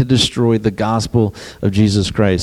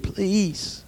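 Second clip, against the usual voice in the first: high, hushed please; a small begging plea; pleading; 9 semitones higher, 10 dB quieter than usual. Acted. Debtor begging the king for mercy, high and hushed. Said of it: "He pleaded".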